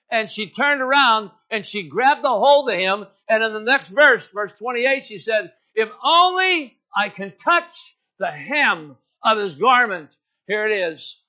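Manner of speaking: loud, slow, ringing sermon delivery; full-force preaching. Acted. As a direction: preach it out to the whole church, drawn out.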